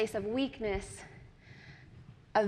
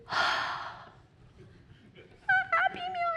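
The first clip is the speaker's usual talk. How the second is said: high, hushed disbelieving question; small-voiced astonishment; amazed child; 4 dB quieter than usual. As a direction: squeak it softly in wonder.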